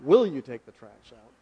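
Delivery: gentle asking, stress on "Will"; polite request. Retold or imitated. Preacher contrasting his wife's command with a polite request, a mild asking tone. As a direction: lift "Will" to make it a kind question.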